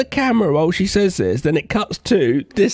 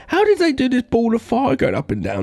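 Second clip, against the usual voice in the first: higher, mock-amazed question; amused wonder; 8 semitones higher than usual. Recalling his reaction to the fireball, higher and amazed. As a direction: marvel at the cheap effect. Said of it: "I was like".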